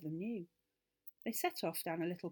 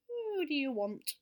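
high, slow, furry little rabbit voice; 8 semitones higher, 4 dB louder, slower than usual. Acted. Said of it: "in a furry sort of voice".

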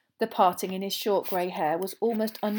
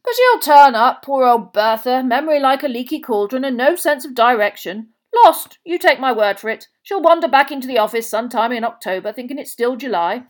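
loud, easy-going, raised voice; comfortable; 5 semitones higher, 12 dB louder than usual. A narrator's reading. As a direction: breezily brush off any worry, at volume. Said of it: "said Bagman comfortably".

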